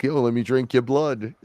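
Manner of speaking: lightly voiced lizard girl. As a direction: pitch it up for a playful creepy request. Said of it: "until she was like".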